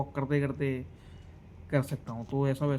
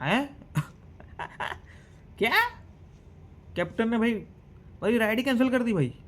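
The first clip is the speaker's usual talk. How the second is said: shocked disbelief; 7 semitones higher than usual. Retold or imitated. Cab cancelled in shock.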